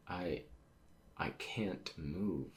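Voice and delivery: slow, breathless whisper; barely-voiced, helpless whisper; weak strained whisper. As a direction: whisper it slowly, as if immobilized. Voices a character unable to move.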